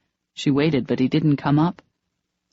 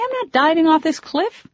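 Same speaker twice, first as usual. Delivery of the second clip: indignant refusal; 13 semitones higher than usual. Acted. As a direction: refuse him flatly. Refusing his dare.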